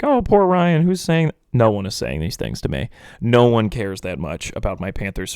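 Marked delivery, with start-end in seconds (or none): 0.0-2.9 higher-pitched pitying listener voice; put-on "poor you" voice; mock sympathy. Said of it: "those of you that listen to this and are like".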